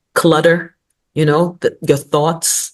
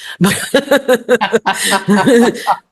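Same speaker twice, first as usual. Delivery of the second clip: mock frozen-face laugh; 6 semitones higher than usual. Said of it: "I'll be like".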